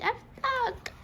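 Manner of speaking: a high, worried little cry; dismayed cry. A narrator's reading. Maid stuck atop the mattresses, crying out in dismay. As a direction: wail it in mild dismay. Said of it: "Peg cried".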